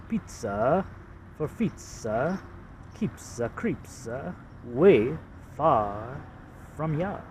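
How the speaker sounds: slow Boston accent, far dragged out as fah. Shown as a demonstration. Showing ad slogan to viewers in a Boston accent. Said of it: "It's a Boston accent".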